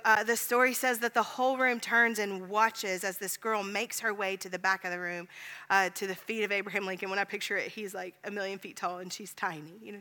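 ordinary talk

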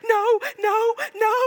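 put-on high, wailing kid protest; whining child. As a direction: cry no like a distraught little boy. Son protests wrappers thrown out.

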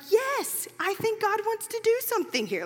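excited quote. Retold or imitated. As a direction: let the hope spill out. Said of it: "she's like".

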